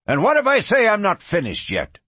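high-pitched, heated demand; angry challenge. Acted. Rancher stops the departing gunman, angry challenge. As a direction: challenge him as he tries to leave.

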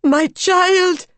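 high, loud, yearning; high emotional cry; anguished cry. A narrator's reading. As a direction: cry out to the lost child. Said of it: "cried Madame X".

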